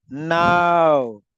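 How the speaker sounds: "Now" held long; stress on NOW. Shown as a demonstration. Teacher meaning not one minute later, NOW stretched. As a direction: stretch "Now" out with full emphasis. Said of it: "you're stressing on that part".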